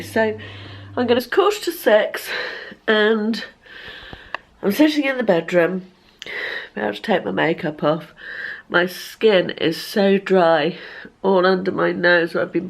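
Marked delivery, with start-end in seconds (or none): none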